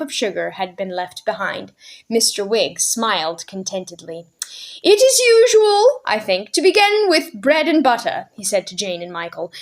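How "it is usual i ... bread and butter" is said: put-on high, merry old man, pleased with himself; jolly old gentleman. Mister Wig explaining tea to Jane and Michael, merry. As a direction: play the host with a twinkle.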